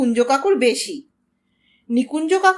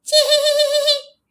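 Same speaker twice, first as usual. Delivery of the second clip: high, drawn-out whinnying neigh; horse neigh; 12 semitones higher than usual. A narrator's reading. Old horse stumbles on the path, shrill high whinny. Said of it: "চেঁচিয়ে উঠল ঘোড়াটা".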